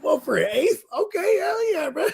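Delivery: squealing high bro voice; frat bro. Retold or imitated. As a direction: shout it up high, thrilled. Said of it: "You know what I mean".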